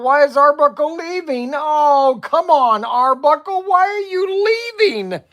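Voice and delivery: high, pleading mock whine at a leaving viewer; playful high-pitched begging. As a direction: whine like a jilted friend, half joking. Streamer pleads with departing viewer.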